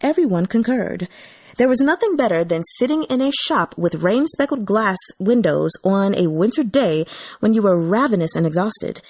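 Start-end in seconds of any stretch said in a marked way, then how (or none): none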